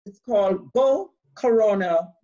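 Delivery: ordinary talk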